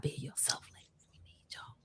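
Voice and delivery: hushed whisper to the ladies. Real, not acted. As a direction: whisper it like a secret. Intimate message to listeners.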